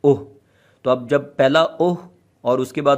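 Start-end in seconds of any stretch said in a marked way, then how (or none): 0.0-0.2 ओह started with a clean glottal catch; glottal stop demo. Shown as a demonstration. Teacher repeats ओह with a throat catch. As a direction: stop the airflow before ओह. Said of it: "दो दफ़ा आपने बोला है ओह".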